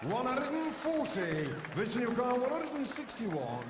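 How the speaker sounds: drawn-out announcer call of the score; darts score caller. Real, not acted.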